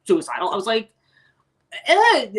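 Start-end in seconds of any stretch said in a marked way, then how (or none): 1.9-2.3 reluctant "eh", high and loud. Retold or imitated. Trainee balking at six hours of training, reluctant. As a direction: balk with a high, loud noise.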